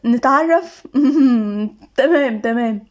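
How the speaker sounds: teasing, a giggle held in; suppressed laughter. A narrator's reading. Bride barely holding in her laugh at groom, amused. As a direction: hum back a laugh while agreeing. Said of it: "حور كانت كاتمة ضحكتها بالعافية وقالت".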